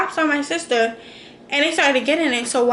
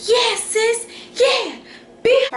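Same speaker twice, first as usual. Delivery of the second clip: hyped cheering; 9 semitones higher, slower than usual. Retold or imitated. Big sister cheers her sister on, high hyped yelling. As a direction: pump up your little sister mid-fight.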